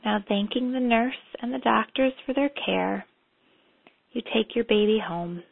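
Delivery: calm, unhurried meditation guide; guided meditation. Acted. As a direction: lead them gently into memory.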